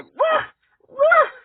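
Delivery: very high, loud woofs; high barking woofs; dog bark. Acted. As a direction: bark like Lassie. Lassie barking.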